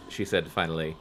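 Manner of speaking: normal talking voice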